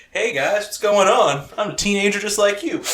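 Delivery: high, loud fake teen greeting; fake teenager. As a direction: play an adult posing as a cool teen. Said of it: "be like".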